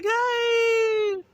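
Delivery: very high, loud, long-held hey; mock greeting squeal. Mimicking a guest greeting everyone, high mock squeal. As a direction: squeal hello like an over-excited guest.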